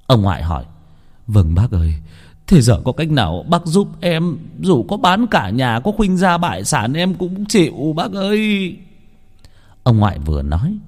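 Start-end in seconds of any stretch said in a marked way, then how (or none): 1.3-8.8 higher, pleading voice near tears; choked crying plea. Acted. Father begs the master to save his son, choking up. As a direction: beg through a choked sob. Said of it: "vừa khóc nghẹn vì thương con".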